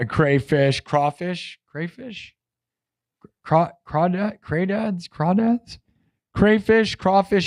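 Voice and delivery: trying crayfish and crawfish back and forth; testing crawfish, crayfish, crawdads aloud; unsure pronunciation. Shown as a demonstration. Streamer unsure how to say crawfish, testing it aloud. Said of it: "How do you say that?".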